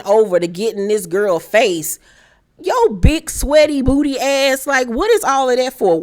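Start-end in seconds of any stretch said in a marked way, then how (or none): 2.6-6.0 mocking callout. Calling out Duffy charging over.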